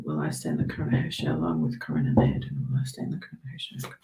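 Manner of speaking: whispered question to the cards; tarot reader whispering the sitter's question over the deck. Real, not acted. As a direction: breathe the question like a private ritual.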